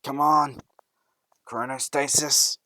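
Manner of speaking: loud, drawn-out shout of the quirk name; shouted quirk call. Acted. Boy racing for the train, shouting his quirk. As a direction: yell it as the doors close.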